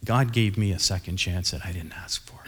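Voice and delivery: hushed unvoiced whisper. Real, not acted. Pastor whispering the lesson to his congregation. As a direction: lean in and whisper the point to the congregation.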